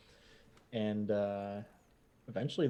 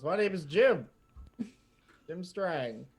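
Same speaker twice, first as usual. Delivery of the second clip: a lightly higher little mouse; mouse Jim; 7 semitones higher than usual. Acted. Jim the mouse greets the party, lightly higher. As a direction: introduce yourself as a small mouse. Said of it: "and he says".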